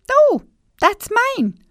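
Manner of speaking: put-on very high little girl voice; high girlish protest; Gina's voice. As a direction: squeak it out like a small girl. Gina, slower now.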